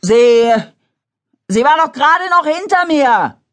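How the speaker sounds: high, loud, worried voice; anxious, breathless. A narrator's reading. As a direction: blurt it out between breaths. Benjamin, panting, worried.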